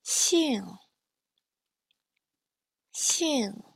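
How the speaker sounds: slow demonstration of 兴 with a falling tone. Shown as a demonstration. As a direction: slide 兴 steadily down in pitch. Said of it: "Now let's do this slowly".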